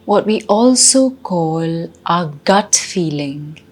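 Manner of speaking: slow meditation guide with long pauses; guided meditation. Acted. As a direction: ease them inward, slowly and unhurriedly.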